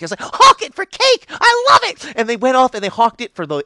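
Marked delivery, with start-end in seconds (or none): 0.2-2.1 very high, loud and slow; a hammed-up thrilled squeal; squealing delight. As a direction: squeal with joy. Delighted pixie.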